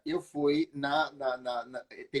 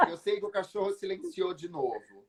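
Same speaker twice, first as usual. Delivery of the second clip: usual speaking voice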